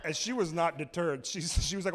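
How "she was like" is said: normal talking voice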